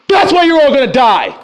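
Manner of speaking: loud angry yell; a furious shout at the congregation; real shout. Real, not acted. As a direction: shout the warning at them. Preacher yells at listeners.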